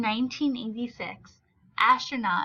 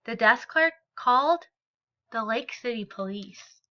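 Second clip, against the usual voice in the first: story reading. A narrator's reading. Teacher reads picture book aloud.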